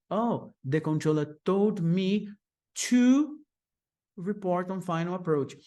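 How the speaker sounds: pause, then punch to; stress on to. Shown as a demonstration. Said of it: "Então ficaria".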